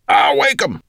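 loud, high, eager dwarf; eager cry. A narrator's reading. Gravelbeard promising Maya, loud and eager. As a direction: burst out with delight. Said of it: "he cried".

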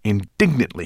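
ordinary delivery, nothing marked

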